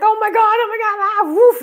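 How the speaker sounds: high, loud thrilled squeals; roller coaster thrill. Retold or imitated. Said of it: "it's kinda like a roller coaster".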